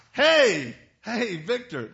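loud, high shout of hey; alarmed shout. Retold or imitated. Associate pastor busting in on a fight, shouting. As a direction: call out sharply to stop a fight.

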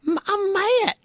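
high, put-on kid's impression; child mimicking a funny accent, high voice; Matt. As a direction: pitch way up, stretch the name. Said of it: "he had a Matt impression".

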